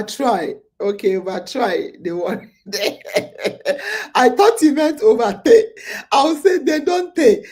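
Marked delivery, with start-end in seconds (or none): 2.7-4.1 burst of laughter. Real, not acted. Laughs at her misreading. 4.1-7.5 laughing. Real, not acted. Laughing at her misreading.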